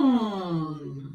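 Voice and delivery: deep demo hum; low hum. Shown as a demonstration. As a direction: hum at the very bottom of the range. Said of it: "And the lowest one more time".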